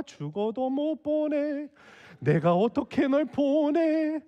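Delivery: hammed-up lament of someone refusing to part; mock weeping plea. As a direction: wail it slowly, pretending to cry. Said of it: "이러면서 우는 거야".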